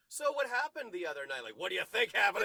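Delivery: loud, high sarcastic retort; mock confrontation. Host voicing the beaten cop snapping at her, mock anger. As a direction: throw it back at her angrily, hammed up.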